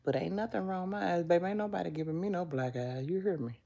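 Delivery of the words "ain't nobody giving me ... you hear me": normal talking voice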